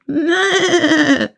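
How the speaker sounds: high, quavering goat bleat; old goat's bleat. Acted. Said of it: "Da meckerte auch die Alte". (hohes Meckern der alten Geiß)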